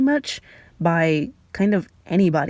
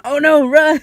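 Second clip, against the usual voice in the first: higher, louder cry to run; panicked shout; 7 semitones higher, 5 dB louder than usual. Retold or imitated. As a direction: yell like a scared kid bolting. Kids flee after hitting garage.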